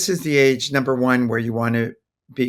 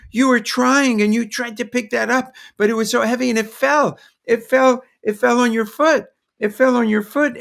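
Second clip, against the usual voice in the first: put-on much higher, louder sing-song echoing a hurt toddler; toddlerese; 10 semitones higher, 3 dB louder than usual. Shown as a demonstration. Parent to a hurt toddler, higher, louder, sing-song toddlerese. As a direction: mirror the child's pain in short repeated bursts. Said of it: "short phrases, repetition, a third of their feelings".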